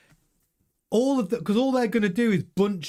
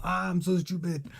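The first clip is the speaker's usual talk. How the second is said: softer, lightly put-on French accent; Leclerc's French accent; 5 dB quieter than usual. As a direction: quietly mimic a French accent. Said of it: "That's my French accent".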